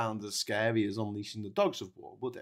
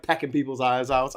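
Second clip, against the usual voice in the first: ordinary talk, nothing marked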